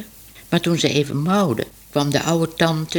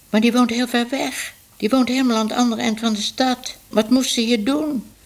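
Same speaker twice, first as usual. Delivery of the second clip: verbaasd; 6 semitones higher than usual. A narrator's reading. Minoes verbaasd over haar zuster.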